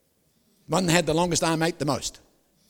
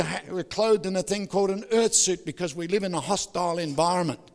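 normal talking voice

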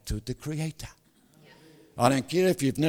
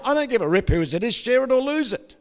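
mock stern grandpa; 5 semitones higher, 4 dB louder than usual. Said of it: "The spirit of slap comes on".

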